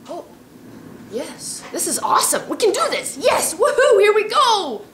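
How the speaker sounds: high and loud, bubbly cheering with a laugh; cheerleader voice. Acted. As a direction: cheer like an overjoyed cheerleader, shouting woohoo. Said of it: "there's a little cheerleader voice".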